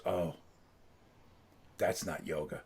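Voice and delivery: low, soft and let down; quietly retold dismay, dropping low; deflated reaction. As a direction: say it as if the air just left the room. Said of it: "the air came out of the room".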